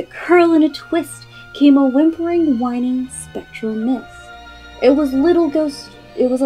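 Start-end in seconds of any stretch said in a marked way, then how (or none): none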